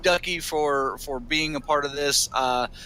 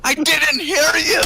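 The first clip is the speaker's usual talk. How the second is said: loud protest; 13 semitones higher, 7 dB louder than usual. Real, not acted. Defends himself after being yelled at.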